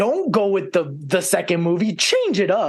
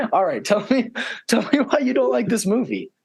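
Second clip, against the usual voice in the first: ordinary delivery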